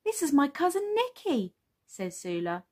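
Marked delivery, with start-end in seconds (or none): 0.0-1.5 put-on high-pitched little girl voice; high storybook child voice; eager child character. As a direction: introduce someone proudly as a child. Voices Sula introducing her cousin.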